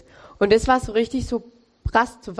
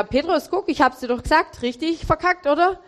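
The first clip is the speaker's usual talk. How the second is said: higher, teasing told-you-so tone; an imagined Jesus rubbing it in, pitched up; mock Jesus voice; 6 semitones higher than usual. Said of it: "Jesus hätte ja tausend Fragen fragen können".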